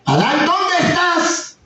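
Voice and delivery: slow, high, booming call of God; a slow, high divine call to a hiding man; God calling Adam. Retold or imitated. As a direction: call out slowly like God searching the garden.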